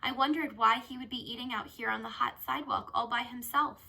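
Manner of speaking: ordinary delivery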